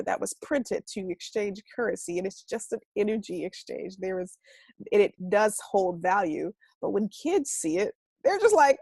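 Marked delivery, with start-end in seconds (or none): none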